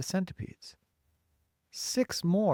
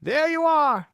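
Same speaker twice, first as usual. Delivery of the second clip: very high, loud cry; calling out; 13 semitones higher, 9 dB louder than usual. A narrator's reading. Police chief proved right about the visitors, calling out. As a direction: call it out at full pitch, vindicated. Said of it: "called the chief of police".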